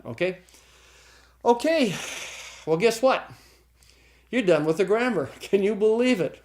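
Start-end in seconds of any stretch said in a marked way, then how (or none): 1.4-6.4 higher, celebratory teacher voice; excited announcement. Real, not acted. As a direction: announce the big milestone with high excitement. Teacher celebrates finishing the grammar section.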